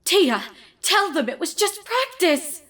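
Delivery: high, desperate plea to her sister; pleading. Acted. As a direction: beg your sister to back you up. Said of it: "looked at her sister pleadingly".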